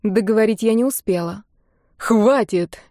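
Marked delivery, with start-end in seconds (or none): 2.0-2.8 sharp cutoff. Acted. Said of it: "резко оборвал меня Кимли".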